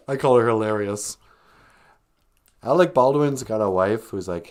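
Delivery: ordinary delivery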